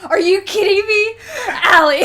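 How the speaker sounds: high, loud laughing outcry; real giddy shock; laughing and shouting her name; laughing disbelief. Real, not acted.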